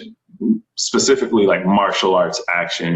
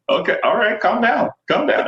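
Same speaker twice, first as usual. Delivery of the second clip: high mock "calm down, calm down"; amused calming colleague. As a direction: talk down an overexcited fan.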